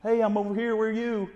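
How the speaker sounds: a hen calling to find the flock; voicing the hen. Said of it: "It's kinda like".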